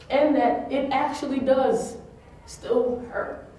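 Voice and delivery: slow, breaking on the last words; whispered tearful admission; hurt. Real, not acted. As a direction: let the voice crack. Tearful confession.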